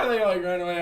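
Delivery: normal talking voice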